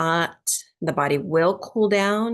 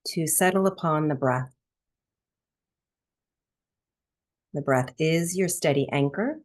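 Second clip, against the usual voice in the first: calm, measured breathwork instruction; guided meditation. Real, not acted. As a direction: ease listeners into stillness, phrase by spacious phrase. Leads breathwork meditation after intro.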